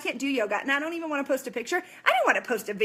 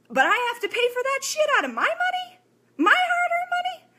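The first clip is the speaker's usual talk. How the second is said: climbing to a much higher, louder indignant outburst; angry rant; 11 semitones higher, 3 dB louder than usual. Real, not acted. Ranting to viewers about paying for others, indignant high outburst. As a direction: let the fury break upward on hard-earned money. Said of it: "I'm, like, fuming".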